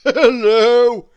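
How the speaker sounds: a big jolly hello down the phone; chuckling Father Christmas. Acted. Father Christmas answering nephew's telephone call, chuckling loudly. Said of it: "chuckled a rather deep, rumbly voice".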